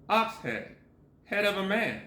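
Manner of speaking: usual speaking voice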